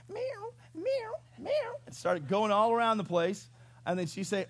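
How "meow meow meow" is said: repeated high-pitched meows; eager string of high cat mews; imitated cat meows. Cat asked if he wants to eat, high imitated meows. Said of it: "And Max would go".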